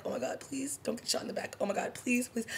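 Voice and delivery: high, hushed mock-panicked begging; anxious pleading. Viewer begging characters not to get shot, anxious pleading.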